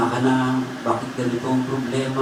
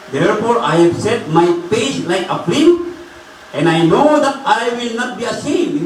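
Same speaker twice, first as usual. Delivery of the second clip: impassioned preaching; 10 semitones higher than usual. Acted. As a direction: declare the verse with conviction. Preaches the verse forcefully. (madamdaming pagbigkas ng talata)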